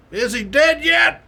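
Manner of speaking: high, slow holler across the pool; imitated trooper's drawn-out call; calling across distance. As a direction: shout a question to someone far off.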